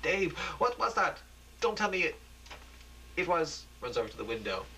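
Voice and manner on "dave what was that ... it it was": high, frantic pleading; terrified woman crying out; frightened screaming. A narrator's reading. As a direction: scream it out in fear. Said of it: "Michelle screaming".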